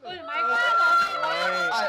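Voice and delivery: very high, loud burst of relief; shrieking relief. Acted. Friend learning Hiếu still breathes, shrieking relief. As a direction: cry out in sudden joy.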